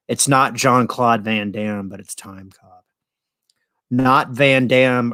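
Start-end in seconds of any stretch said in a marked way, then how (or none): 1.9-2.9 breathy whispered aside, much softer. Real, not acted. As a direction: drop into a secret whisper for the joke. Aside about Van Damme.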